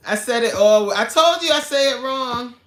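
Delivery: normal talking voice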